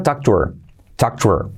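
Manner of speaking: "talk to her" said "talk tu 'er"; linked, no h; dropped h. Shown as a demonstration. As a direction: drop the h of "her". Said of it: "Saltamos esa H".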